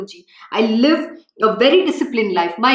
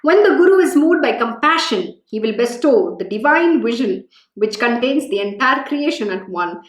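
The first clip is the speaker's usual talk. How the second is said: calm, lightly lowered reading voice; scripture reading; 4 semitones lower than usual. Acted. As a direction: read the sacred story gently to the listeners.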